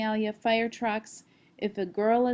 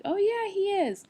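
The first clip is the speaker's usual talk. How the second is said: proud agreeing mom; 10 semitones higher than usual. Retold or imitated. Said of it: "And I might say something like".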